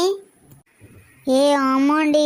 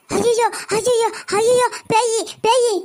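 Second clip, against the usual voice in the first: scared cry; 6 semitones higher than usual. Acted. Mother wakes at a ghost.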